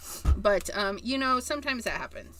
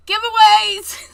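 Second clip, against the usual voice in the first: much higher and louder, a slow triumphant cry; excited exclamation; 10 semitones higher, 14 dB louder than usual. Real, not acted. Crafter answering her own question with a triumphant cry. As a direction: announce it like the winning answer.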